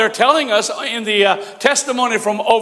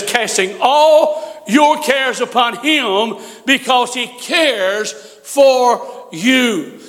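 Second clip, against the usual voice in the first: slow scripture reading; much slower than usual. Acted. Preacher quoting scripture slowly.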